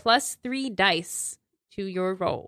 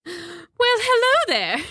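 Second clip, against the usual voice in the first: hammed-up high character greeting; trial character voice; 12 semitones higher, 3 dB louder than usual. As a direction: overplay a high-pitched hello. Game master tries out sheriff's voice.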